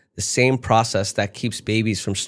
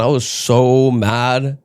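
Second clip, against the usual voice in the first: normal talking voice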